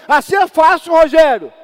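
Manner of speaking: loud, high mock outburst; mock shout. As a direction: shout the complainer's line in mockery. Mocking complainer quote in sermon. (grito imitado em tom de deboche)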